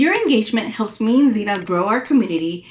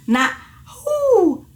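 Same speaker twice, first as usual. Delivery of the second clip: wrong hoo; 10 semitones higher than usual. Shown as a demonstration. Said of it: "make your voice like that".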